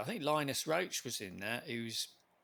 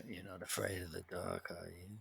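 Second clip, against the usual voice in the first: much lower and much softer, a low murmured movie-tough-guy voice; Vin Diesel impression; 8 semitones lower, 11 dB quieter than usual. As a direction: drop the voice right down and menace it quietly. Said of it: "Vin Diesel impression".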